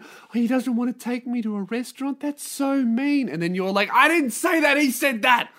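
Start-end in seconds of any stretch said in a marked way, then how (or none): 0.0-3.3 put-on high, pouting girlfriend voice; wounded girlfriend. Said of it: "she starts going". 3.9-5.5 high, loud mock-outraged yell; indignant denial. Boyfriend protests being blamed.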